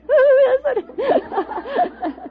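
high, slow, shaky call through laughter; a scared woman trying to sound brave; quavering bravest voice. Retold or imitated. Woman calling out to an intruder at midnight, mock brave quaver. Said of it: "My bravest voice was".

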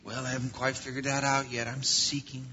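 lightly voiced vague drifter; a hint of a dreamy seeker; aimless seeker. Wanderer answers where going.

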